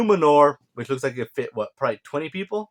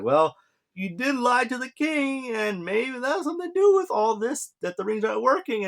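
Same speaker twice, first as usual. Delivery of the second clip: high, wheedling mock Sauron voice, much higher than his own; Sauron sowing doubt; 11 semitones higher than usual. Sauron whispering doubts to the elf, high wheedling mock voice. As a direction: play Sauron as a sly, squeaky schemer. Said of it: "He's like".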